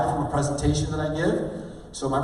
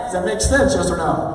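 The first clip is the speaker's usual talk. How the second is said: loud, high question thrown to the crowd; rousing audience check; rallying tie-down; 4 semitones higher, 7 dB louder than usual. Shown as a demonstration. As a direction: demand an answer from the room. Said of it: "I just did it right there".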